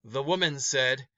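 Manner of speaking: ordinary delivery, nothing marked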